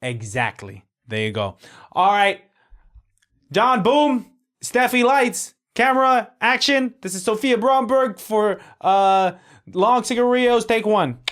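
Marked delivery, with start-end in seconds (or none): 1.9-11.2 loud, high slate announcement; director calling out. Acted. Director starting take one with crew, calling out. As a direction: shout the roll-call across the set.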